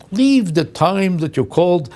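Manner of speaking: patronizing machine instruction. Retold or imitated. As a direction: instruct like to an idiot. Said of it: "Then you say".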